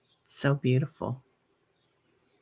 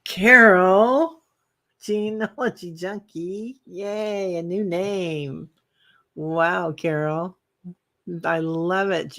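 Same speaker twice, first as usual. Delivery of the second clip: sing-song excitement; 6 semitones higher, slower than usual. Real, not acted. Reads a viewer's new name.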